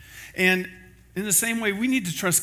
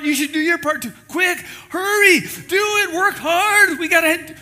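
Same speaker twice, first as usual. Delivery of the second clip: much higher, louder urgent yells; frantic shouting; 14 semitones higher, 8 dB louder than usual. Retold or imitated. Seatmate urges everyone to work on the plane, frantic high shouting. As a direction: bark urges like a panicked passenger. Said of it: "the guy next to you is like".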